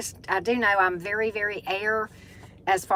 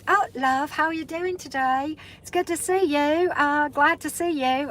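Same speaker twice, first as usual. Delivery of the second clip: higher-pitched amateur British accent; 7 semitones higher than usual. Shown as a demonstration. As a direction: attempt a chirpy British greeting, pitched up. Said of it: "my poor version attempt at it".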